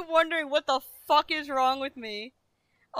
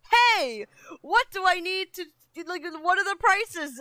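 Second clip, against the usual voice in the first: higher, louder quoting herself; loud mock self-question; loud self-quote; 6 semitones higher, 5 dB louder than usual. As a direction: act out her own question. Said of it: "I'm asking myself".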